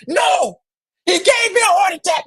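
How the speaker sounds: high, loud protest; exasperated shout. Retold or imitated. Fan protesting to the friend who says calm down, high worked-up shout. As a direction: yell back at the friend, still worked up. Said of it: "I'm like".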